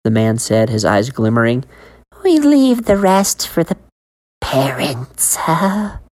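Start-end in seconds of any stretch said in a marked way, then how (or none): 2.2-6.0 sinister vendor. Acted. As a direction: share the dark joke. Man's confession.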